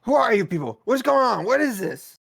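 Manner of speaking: loud, high in-character challenge; demanding call. Acted. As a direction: call out to strangers, pitched high. Player confronts the arguing strangers.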